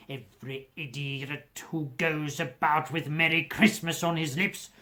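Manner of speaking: put-on miserly old man ranting; indignant. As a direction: spit it out in disgust at Christmas. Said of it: "said Scrooge indignantly".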